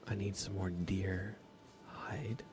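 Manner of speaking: usual speaking voice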